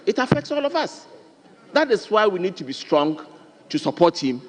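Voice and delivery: rally the house behind him; impassioned appeal. Real, not acted.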